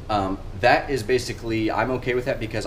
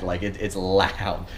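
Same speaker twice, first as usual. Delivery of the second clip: slow, drawn-out LOUD; stress on LOUD; much slower than usual. Real, not acted. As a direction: lean hard on loud. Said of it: "I'm putting as much emphasis on that".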